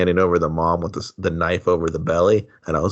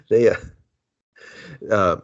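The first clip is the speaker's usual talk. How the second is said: ordinary talk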